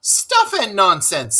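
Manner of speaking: a touch of huffy indignation; mock angry. Toad scoffs at the ferret, mildly indignant. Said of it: "said the Toad very angrily".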